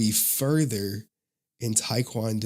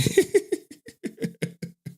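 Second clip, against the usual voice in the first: high breathy laugh. Real, not acted. After shocked yelling.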